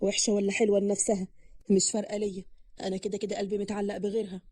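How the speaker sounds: low, soft, uncaring male voice; indifferent. A narrator's reading. Groom says his heart is elsewhere, indifferent. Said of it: "قاسم بعدم مبالاة".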